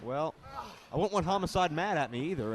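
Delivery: ordinary delivery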